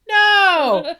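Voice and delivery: sudden loud flat "No!". Retold or imitated. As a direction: blurt it out loudly, no fear. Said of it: "She just says no loudly".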